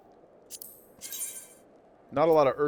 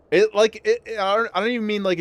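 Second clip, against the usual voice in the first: excited; 7 semitones higher, 5 dB louder than usual. Real, not acted. Animated rant about chip.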